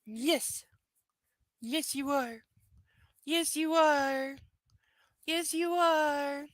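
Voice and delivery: high, slow cooing to a pet. Real, not acted. Owner cooing slowly and high at her dog. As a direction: coo it up high and slow like praising a puppy.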